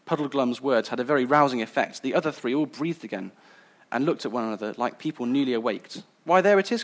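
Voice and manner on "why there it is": high, loud joyful cry; cried out. A narrator's reading. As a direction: cry it out with sudden joy. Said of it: "cried the prince".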